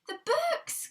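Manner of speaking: high, loud cry of alarm. Acted. Child Peter discovers the books gone, crying out. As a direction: Peter cries out in shock. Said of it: "cried out Peter".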